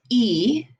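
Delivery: "E" said as "ee"; French I, pronounced "ee"; French letter I. Shown as a demonstration. Teacher explaining French I to class, said "ee". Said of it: "letter I in French comes out as".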